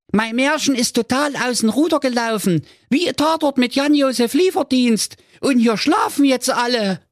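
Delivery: put-on high-pitched fretful young prince; a pampered prince in a panic; whiny high prince. Prince complains on phone. (hohe, weinerliche Prinzenstimme)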